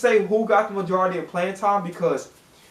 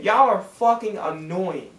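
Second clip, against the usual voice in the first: ordinary delivery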